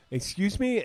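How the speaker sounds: high-pitched, imitated affronted query; put-on offence, pitched well up; mock indignant. Imitating the offended prank caller, mock indignant. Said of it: "angry, but also kinda laughing".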